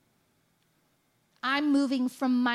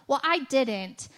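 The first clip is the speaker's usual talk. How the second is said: usual speaking voice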